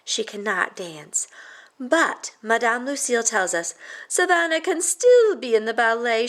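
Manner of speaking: usual speaking voice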